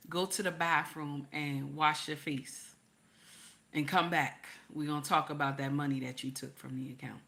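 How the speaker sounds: low, soft, controlled husband voice; imitating unmoved husband. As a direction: quietly lay down the order, no sympathy. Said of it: "he said just like this".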